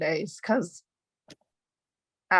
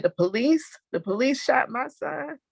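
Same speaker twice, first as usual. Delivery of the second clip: higher, rising stunned echo; disbelieving question, pitch climbing; shocked disbelief; 7 semitones higher than usual. Retold or imitated. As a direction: repeat it like you can't believe it. Mother hears police shot son.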